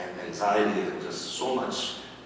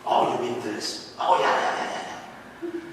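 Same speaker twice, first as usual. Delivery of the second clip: mirroring therapist; 2 semitones higher, 4 dB louder, slower than usual. Retold or imitated. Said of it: "just repeat what they say back".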